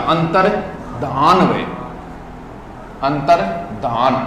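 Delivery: अंतर्धान drawn out slowly with no य sound; teacher slowly models अंतर्धान; slow correct pronunciation. Shown as a demonstration. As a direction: say अंतर्धान slowly, clean ध then आन. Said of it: "अंतर्ध्यान को नहीं हुआ".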